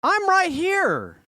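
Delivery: high, slow, pointed retort; scolding wife. Retold or imitated. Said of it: "And she's like".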